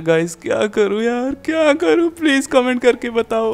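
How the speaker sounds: very high-pitched put-on whine; high, tearful mock plea; mock despairing wail. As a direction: wail helplessly to the viewers, hammed up. Captive wails to viewers.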